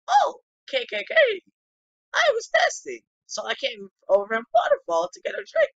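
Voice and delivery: high, loud put-on character voice; game character voice. Character explaining the trip from Waterfall, high loud voice. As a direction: push the pitch way up and belt it out.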